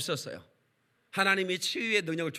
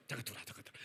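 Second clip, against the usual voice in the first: unvoiced whisper of come in; hushed urging; 6 semitones lower, 16 dB quieter, much faster than usual. Uncle whispering to his niece to come inside. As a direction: whisper him in secretly.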